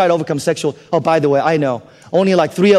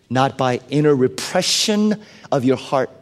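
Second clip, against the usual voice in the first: usual speaking voice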